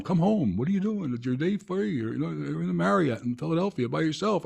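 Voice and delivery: hammed-up nagging voice; mock nagging callers. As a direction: ape the worried family nagging. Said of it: "people calling".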